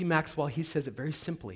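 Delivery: ordinary talk, nothing marked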